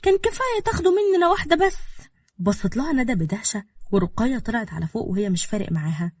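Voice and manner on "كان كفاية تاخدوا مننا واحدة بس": high, loud, unbothered retort; cool insolence. A narrator's reading. Girl answering the furious older woman, cool insolence. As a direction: answer back coolly, pitched high. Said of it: "بكل برود وردت".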